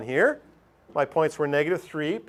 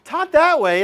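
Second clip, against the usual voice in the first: normal talking voice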